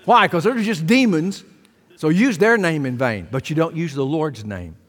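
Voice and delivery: usual speaking voice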